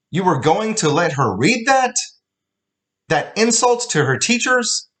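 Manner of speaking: put-on very high, indignant accented shout; outraged shout. Father shouting at mother about the insult. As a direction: accuse her furiously.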